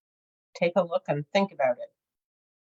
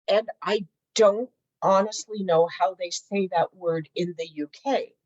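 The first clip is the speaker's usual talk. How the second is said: ordinary talk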